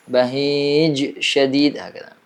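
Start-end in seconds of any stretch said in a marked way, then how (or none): none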